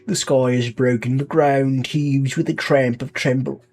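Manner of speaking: speechify like a British leader on tape; British accent. Acted. Leader welcoming citizens to Un London, in a light British accent. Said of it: "I have to do the accent".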